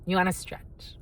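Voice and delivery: ordinary talk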